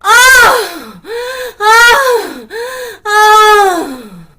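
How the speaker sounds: very loud, very high crying moans; a mock climax moan, shouted; right on it moan. Shown as a demonstration. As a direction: hit each ah full force and high. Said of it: "The right on it moan".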